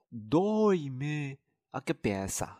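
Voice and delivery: dói-me a cabeça said slowly for the class to repeat; slow model for repeating. Shown as a demonstration. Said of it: "大家要跟老师一起来说哦". (老师带读，慢速说dói-me a cabeça)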